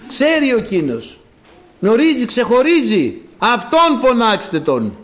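high, ringing exhortation with stretched words; impassioned preaching. Acted. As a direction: drive each phrase home to the congregation.

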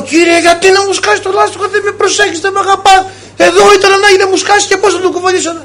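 high, fast whining at God; mock whining complaint. Priest imitating himself complaining to God over a flat tyre, high mock whine. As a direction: whine like a spoiled child.

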